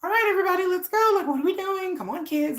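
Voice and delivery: put-on high, singsong mom rallying her kids; peppy mom-to-kids voice; talking-to-kids voice. Shown as a demonstration. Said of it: "the way I talk to my kids".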